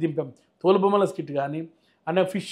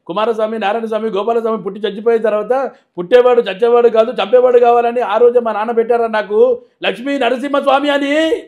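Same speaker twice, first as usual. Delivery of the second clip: louder, higher rolling hero monologue; Balayya dialogue; 4 semitones higher, 7 dB louder than usual. Retold or imitated. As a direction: build the name list into a roar. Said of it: "perfect dialogue అయితే".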